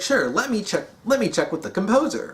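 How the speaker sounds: high, lilting AI helper voice; Exuberant Witness. Retold or imitated. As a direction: answer in a high, swooping monitor voice. Voices monitor answering request for help.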